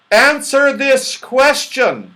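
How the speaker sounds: high, loud and slow call down; teasing shout. A narrator's reading. Moonface leaning overboard to tease Dame Snap, shouting. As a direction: shout it over the side. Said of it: "shouted Moonface".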